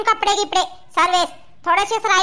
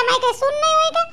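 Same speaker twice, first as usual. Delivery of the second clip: ordinary delivery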